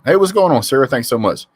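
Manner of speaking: mocking the coach's cheerful last-minute goodbye; mock cheery greeting. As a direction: chirp it like a breezy brush-off. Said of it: "come up and say the day before".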